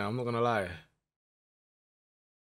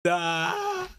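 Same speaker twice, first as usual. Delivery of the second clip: tense shout; 5 semitones higher than usual. Real, not acted. Reacting to penalty shootout.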